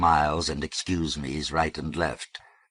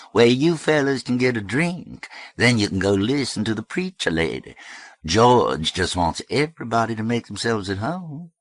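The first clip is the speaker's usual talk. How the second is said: raised, sing-song South Alabama voice; South Alabama accent; 6 semitones higher, 4 dB louder than usual. A narrator's reading. Blonde woman pointing fellas to the bar, South Alabama accent. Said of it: "identify as South Alabama Flats".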